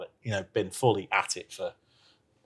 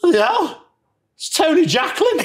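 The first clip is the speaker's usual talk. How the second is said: put-on much higher and louder, blurting in drunken amazement; drunk mate's starstruck yell; 7 dB louder than usual. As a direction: shout it like a tipsy fan spotting a legend. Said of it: "he's a bit drunk".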